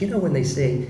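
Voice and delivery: a light Trump voice, explaining; loosely imitating the president making a point; lightly voiced Trump; Trump impression. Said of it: "He goes".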